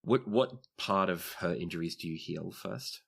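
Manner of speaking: ordinary delivery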